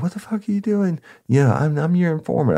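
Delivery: outraged informant. Retold or imitated. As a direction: squeal it like a betrayed snitch. Informant protests arrest, high voice.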